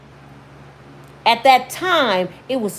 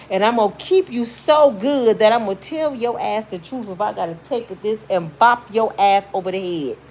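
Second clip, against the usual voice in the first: normal talking voice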